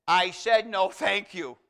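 imitated loud, insistent rebuff; retold telling-off, hit hard at the start; firm repeated refusal. Lady firmly repeats refusal to husband.

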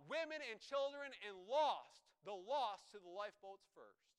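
preacher reenacting a desperate shout; shouting on the deck. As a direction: call it across a sinking deck. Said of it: "he was shouting on the deck".

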